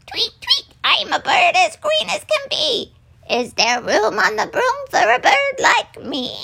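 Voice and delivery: very high, chirpy bird voice; little bird. A narrator's reading. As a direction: squeak it out like a tiny bird. Narrator voices the green bird.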